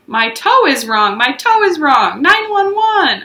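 high, slow mock wail; yelling. Junie yelling to Mother, mock wail. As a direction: wail it out slowly. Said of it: "I yelled".

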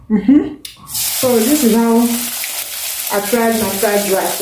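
talking with mouth full; words round a bite of food; chewing while talking. Real, not acted. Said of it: "sorry I'm chewing my mouth".

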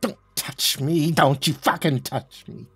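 scared and angry. Acted. Recoils after collapsing, sobbing.

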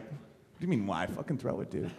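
low, quiet impatient dog; a chill dog getting impatient. Retold or imitated.

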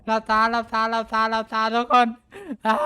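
high, loud frightened yelling; panicked shout. Real, not acted. Panics at scary scene.

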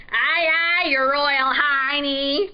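loud mocking pirate jeer; mock royalty in a big pirate voice. A narrator's reading. Pirate answering the prince's demand, jeering. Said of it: "the pirate jeered".

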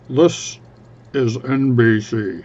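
a light mock news anchor read, slowed; drawn out like a TV network sign-on; a hint of a broadcast announcer. Shown as a demonstration. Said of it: "N B C News accent".

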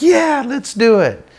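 high, drawn-out excited yeah; acted-out keen excitement; eager agreement. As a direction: jump at the idea in a high pitch. Eager reply to water skiing plan.

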